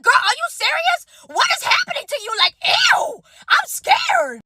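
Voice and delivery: horrified, pitch climbing into a squeal; shocked disbelief. Retold or imitated. To her rude friend, high shocked squeal.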